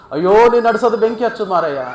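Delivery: mock-exasperated cry of disbelief; exasperated amazement. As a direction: exclaim as a dumbfounded officer. Astonished officer exclaims in story.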